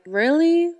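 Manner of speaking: high, questioning "really?"; disbelieving. Acted. Father to daughter blaming her imaginary friend, disbelieving. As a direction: lift it in mild disbelief. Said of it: "looks at her like".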